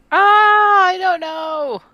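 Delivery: high, loud wail easing off; agonized indecision. Real, not acted. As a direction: whine it high, unable to decide. Torn over a game decision.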